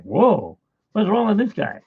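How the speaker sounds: rising disbelief at the guy; baffled. Retold or imitated. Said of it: "I'm like".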